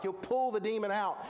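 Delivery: usual speaking voice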